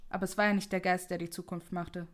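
plain reading voice

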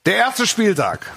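high, slow announcer voice; sports announcer. Acted. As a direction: call the result like a big announcement.

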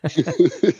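short, high-pitched chuckle; amused laugh. Real, not acted. Co-host amused by Malcolm's spoiled evening, chuckling. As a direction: burst into a brief high chuckle.

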